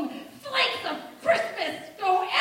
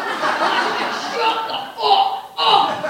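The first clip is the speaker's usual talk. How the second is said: loud, higher-pitched blow-up; acted furious yell; 4 semitones higher, 10 dB louder than usual. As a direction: explode in a family shouting match. Acted quarrel blowing up into yelling.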